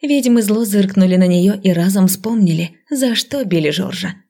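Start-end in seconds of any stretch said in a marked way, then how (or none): none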